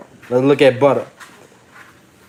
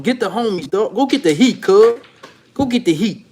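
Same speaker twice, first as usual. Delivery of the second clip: higher West Coast gangster accent, a bit hammed-up; Cali voice; 4 semitones higher than usual. Shown as a demonstration. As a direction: put on a Cali homie accent, lean into cuz. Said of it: "I'm gonna try to put my Cali voice on".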